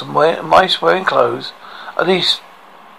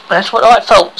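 higher, louder queenly put-down; imperious queen; 6 semitones higher, 6 dB louder than usual. A narrator's reading. Queen scolds her slave.

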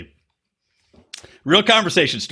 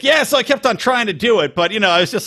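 pitched-up take on a friend; imitating a buddy; 7 semitones higher than usual. Buddy explaining his failed attempts, lightly mimicked in a higher voice. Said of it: "and he's like".

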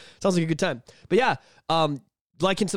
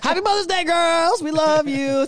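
excited shout; 13 semitones higher, 6 dB louder, slower than usual. Acted. Shouts greeting to mothers.

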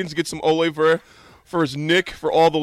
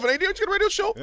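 much higher mocking voice; much faster than usual. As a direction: pitch it way up, mocking. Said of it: "mock caller".

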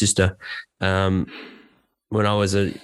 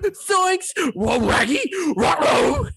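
very high, loose cartoon exclamations; much slower than usual. Shown as a demonstration. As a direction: yelp it like a goofy cartoon. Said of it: "I can do".

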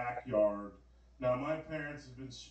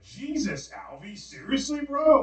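high, incredulous mock protest; imitated appalled friends; 10 semitones higher, 4 dB louder, slower than usual. Friends to the dreamer after the killing, mock appalled disbelief.

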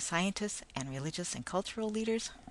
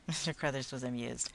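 ordinary delivery

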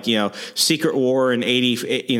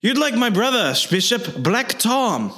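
much higher mock Irish brogue; high cartoon stage-Irish voice; Banshee Irish brogue; 11 semitones higher than usual. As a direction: squeak out a cartoon Irish accent. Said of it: "I love the way Banshee talks".

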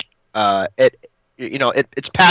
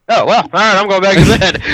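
higher, louder, off-hand shrug; dismissing it to get back to sleep; breezy relief; 6 semitones higher, 5 dB louder than usual. Retold or imitated. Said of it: "I was like".

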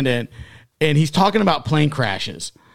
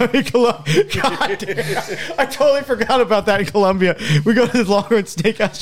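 laughing; 9 semitones higher, 4 dB louder than usual. Real, not acted. Laughing while retelling.

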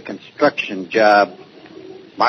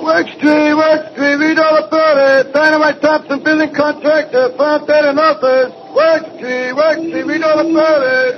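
newsboy calling; 13 semitones higher than usual. Acted. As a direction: call the headline out to passers-by.

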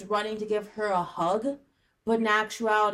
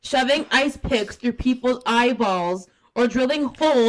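loud, emphatic rant into the mic; yelling; 3 semitones higher, 7 dB louder than usual. Real, not acted. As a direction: shout it so nobody misses it. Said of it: "That's gonna be so loud".

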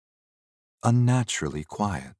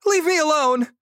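very high, loud frightened scream; high-pitched scream; 6 dB louder than usual. Acted. Young man warding off the ghost, high-pitched scream. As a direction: scream it in a squeaky panic. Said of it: "he screamed".

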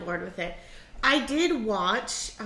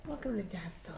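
low sultry try; 10 dB quieter than usual. Pretend. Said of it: "I think you might be worse than".

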